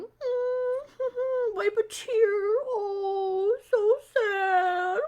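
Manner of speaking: high, whimpering mock sob for the kids; a high, hammed-up boohoo; hammed-up pretend crying. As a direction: play-act crying, wiping a tear. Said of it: "So how do we act sad?".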